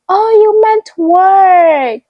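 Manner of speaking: loud, high, drawn-out dawning; exaggerated imitation of getting it; mimicked sudden realisation. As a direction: stretch it out high as if it finally clicks. Mimics listener finally understanding.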